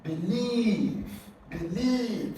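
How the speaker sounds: Believe as be-LIEVE, high on LIEVE then falling; stress on second syllable. Shown as a demonstration. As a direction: model believe twice, leaning on LIEVE. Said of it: "the second syllable is stressed".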